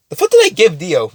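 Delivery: real flustered outburst; exasperated self-questioning. Real, not acted. Teller loses track of own plot.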